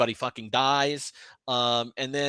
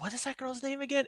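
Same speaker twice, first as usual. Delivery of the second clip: high puzzled voice; 12 semitones higher, 9 dB quieter, faster than usual. Retold or imitated. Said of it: "She's like".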